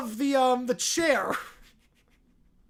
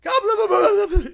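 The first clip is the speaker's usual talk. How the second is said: laughing; 7 semitones higher, 4 dB louder than usual. Real, not acted. Laughing at odd video.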